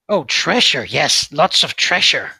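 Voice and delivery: loud, slow greedy little creature. Acted. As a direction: savor the word treasure greedily. Creature eyes their treasure.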